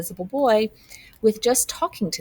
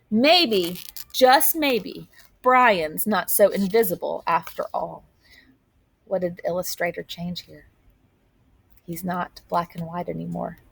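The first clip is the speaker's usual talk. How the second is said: ordinary delivery, nothing marked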